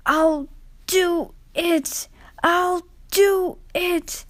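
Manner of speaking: high, slow, effortful cry; straining triumph. A narrator's reading. Rheneas straining up the line, high and effortful. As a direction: push every word out with effort.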